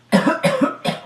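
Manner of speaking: high, put-on cough; fake sick cough. Child faking fever to skip school, fake cough. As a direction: cough as if feigning illness. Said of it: "nilalagnat ako para di na ako papasukin".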